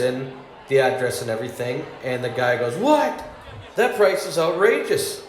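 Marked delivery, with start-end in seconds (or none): 2.8-5.2 shocked outburst. Retold or imitated. Customer sees the price and yelps in disbelief. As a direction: blurt it out in disbelief.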